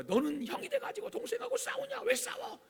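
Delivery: very high-pitched mock scolding; scolding mother. Mother telling off the already angry older brother, high-pitched scolding. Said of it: "어머니가".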